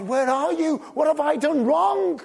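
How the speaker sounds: high, loud anguished cry to God; David crying out. Acted. David crying out to God from the cave, anguished loud plea. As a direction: cry out like a desperate fugitive. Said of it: "he's crying out to God".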